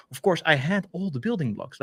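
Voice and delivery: normal talking voice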